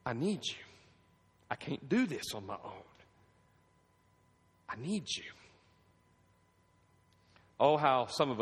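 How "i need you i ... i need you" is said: softer, hushed plea to God; soft pleading prayer. Pretend. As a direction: pray it quietly, almost breaking. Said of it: "you too are saying".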